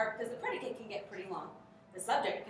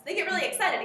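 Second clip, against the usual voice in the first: excited; 9 semitones higher, 9 dB louder, faster than usual. Real, not acted. Describing excited kids.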